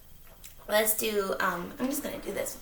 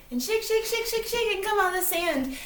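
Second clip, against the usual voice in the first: higher, singsong shake chant; playful; 10 semitones higher than usual. Pretend. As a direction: get the kids wiggling.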